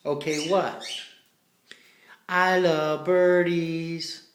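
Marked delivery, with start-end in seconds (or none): 2.3-4.3 drawn-out slow teaching line; slow prompt for the bird. Shown as a demonstration. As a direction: say it slowly for the parrot. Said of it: "I wanna hear, "Do you love birdies?".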